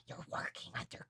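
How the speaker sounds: ominous whisper. Real, not acted. Stressing what working under Kaiba means, whispered. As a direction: drop to a whisper for effect.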